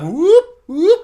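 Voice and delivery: high, loud whoop of glee; hammed-up elf cheer; mock battle whoop. Galadriel acting out a fight cheer.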